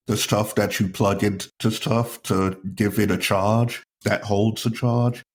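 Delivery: ordinary talk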